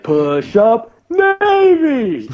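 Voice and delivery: drawn-out call leaping to a high yell; mock cadence call. Corpsmen pranking the PT leader, high yelled cadence. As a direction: belt the answer high as a cheeky joke. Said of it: "and you're like".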